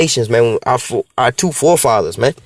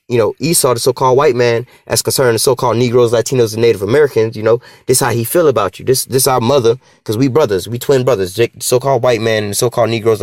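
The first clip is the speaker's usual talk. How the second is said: usual speaking voice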